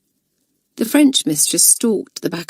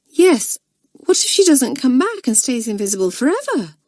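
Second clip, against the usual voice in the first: schoolgirl; 7 semitones higher than usual. Acted. Pam joins in.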